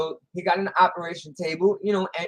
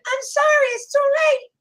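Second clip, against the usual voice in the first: loud, piercing falsetto; squeaky punchline voice; 6 dB louder than usual. Acted. Doctor tells husband it's too late, squeaking loudly.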